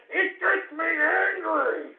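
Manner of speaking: high, slow, strained cry; angry outburst. Real, not acted. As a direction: stretch it out as the anger rises.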